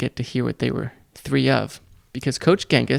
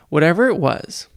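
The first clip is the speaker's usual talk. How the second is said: plain reading voice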